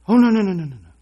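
high, loud mock-panicked no no no; panicked refusal. Preacher playing a believer balking at letting God inside, mock panic. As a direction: shriek the refusal in mock alarm.

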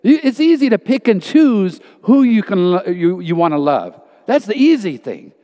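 ordinary delivery

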